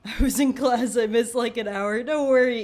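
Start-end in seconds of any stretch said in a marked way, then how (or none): 2.0-2.6 excited greeting. Real, not acted. Greeting chat, loud.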